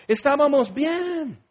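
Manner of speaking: high, slow melodramatic whine; mock whiny Israelite. Preacher mimics Israelites longing for Egypt, slow high whine. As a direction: sigh it out like a spoiled drama queen.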